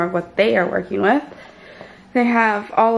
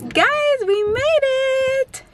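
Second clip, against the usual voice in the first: excited; much slower than usual. Real, not acted. Arriving at the new place.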